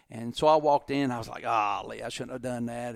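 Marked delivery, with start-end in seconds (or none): 1.4-3.0 quietly regretting it, as retold; rueful. Recalls regretting his outburst.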